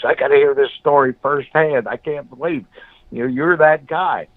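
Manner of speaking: high and eager, near disbelief; a lightly voiced old man, amazed; excited old senator. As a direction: pitch up with delighted astonishment. Old senator stunned, stands up.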